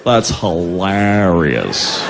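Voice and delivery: very low, long-drawn dopey reply; imitation of a slow, slack-lipped guy; sluggish dude. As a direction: drag it right out, low and lifeless.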